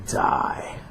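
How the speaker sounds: unvoiced menacing whisper; a killer's whisper. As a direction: breathe the last word out. Whispered final die.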